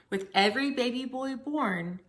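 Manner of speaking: plain reading voice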